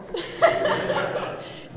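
airy, high burst of laughter; breathy laugh. Real, not acted. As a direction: let out a loud breathy laugh. Laughs after the joke.

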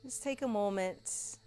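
soft, slow guided meditation voice; soft spa whisper. Acted. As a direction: lull them into stillness. Guided relaxation in Shavasana.

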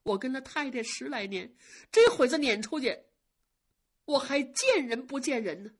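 voiced servant girl begging for mercy; pleading maid. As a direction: plead desperately not to be sent away. Maid begging not to be dismissed.

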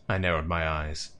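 usual speaking voice

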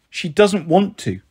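usual speaking voice

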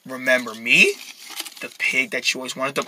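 low, soft menacing pig; sinister pig. Acted. Pig revealing itself to the rabbit, sinister. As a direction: speak low and softly, with menace.

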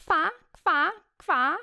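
high quack; duck quacking. Acted. Reading the poem to kids, a high duck quack. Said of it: "Kaczka kwacze".

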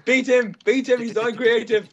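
loud high cheering shout. Real, not acted. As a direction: yell them on to attack. Egging others to attack a player.

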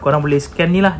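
ordinary delivery

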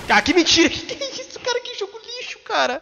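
furious high-pitched outburst; raging gamer. Real, not acted. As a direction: yell in disbelief at the game. Gamer raging at game announcer.

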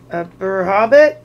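loud rising startled question; puzzled disbelief, rising; startled trolls. Acted. The trolls to Bilbo, a loud startled echo. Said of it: "a bit startled".

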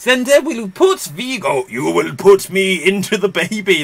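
slightly put-on villain voice; theatrical, pretend-menacing delivery; mock film villain. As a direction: announce the evil plan with ham. Acts villain in film retelling.

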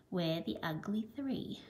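last line of the chant dropped soft; fading quiet close. A narrator's reading. Teacher ending the animals' song softly. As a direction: let the final line fade away. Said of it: "singing this song".

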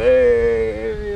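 loud, high mock cheer from the crowd; rowdy crowd yell. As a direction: belt it out like a rowdy pub crowd. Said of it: "everybody's going".

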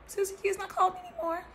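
high, quick musing to herself; light, high-pitched self-talk, played out; mock wondering. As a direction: wonder aloud in a high, hurried voice. Acting out her own musing.

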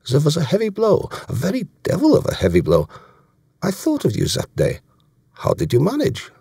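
put-on high-pitched German-accented officer; German accent, pitched well up; German commander, impressed. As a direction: recall it with relish in a German accent. Voicing German commander in dialogue.

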